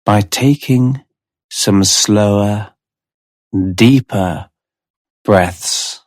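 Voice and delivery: slow, measured hypnotherapist voice with long pauses; unhurried guided-trance delivery; hypnotic relaxation induction. Real, not acted. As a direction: ease the listener down into relaxation, a few words at a time.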